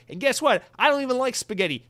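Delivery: high, loud comic indignation; hammed-up rant, pitch shooting up; mock outraged rant. Mock rant against Italians.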